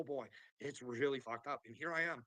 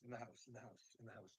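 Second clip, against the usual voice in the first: fading echo; 2 semitones lower, 13 dB quieter than usual. Pretend. Echoing his own announcement.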